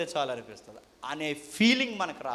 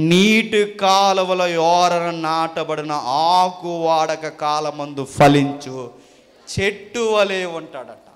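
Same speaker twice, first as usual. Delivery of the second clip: scripture recitation; 8 dB louder, slower than usual. Real, not acted. Preacher quoting the tree verse to congregation, slow scripture recitation. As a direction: recite the verse slowly, stretching the words.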